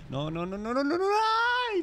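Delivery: startled cry; real panic ending in a very high cry; panicked yelp. Real, not acted.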